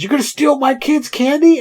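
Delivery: put-on a furious father confronting the boys; angry dad. Angry dad kicks door open.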